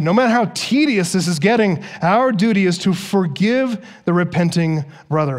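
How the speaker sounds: ordinary talk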